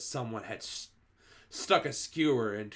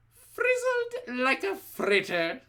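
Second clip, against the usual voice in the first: much higher, slow cackling gloat; gloating witch; 6 dB louder, slower than usual. A narrator's reading. Grand High Witch gloating over the victim, high slow gloat. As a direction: relish the kill.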